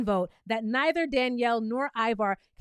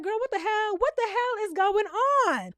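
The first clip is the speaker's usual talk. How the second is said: stunned disbelief; 7 semitones higher than usual. Retold or imitated. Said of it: "I'd been like".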